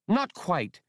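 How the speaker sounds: plain reading voice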